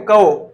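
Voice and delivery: कौ said loudly with a gliding au diphthong; कौ with the vowel opening from a into u; Sanskrit au vowel. Shown as a demonstration. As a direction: demonstrate Sanskrit कौ, emphatic and loud. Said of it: "इसको ऐसे पढ़ा जाता है संस्कृत में".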